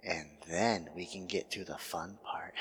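breathy teasing whisper. Acted. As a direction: whisper it like a secret. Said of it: "repeated with whisper".

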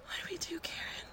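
scared hushed whisper. Acted. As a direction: whisper so the killer can't hear. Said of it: "Why are we whispering?".

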